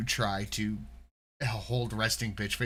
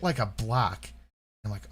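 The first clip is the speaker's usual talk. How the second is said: ordinary talk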